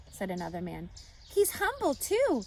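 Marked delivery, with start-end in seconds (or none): 1.3-2.4 woman bystander. A narrator's reading. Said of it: "said a woman".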